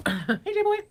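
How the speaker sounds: high sing-song call to a pet. Real, not acted. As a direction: coo at the dog brightly.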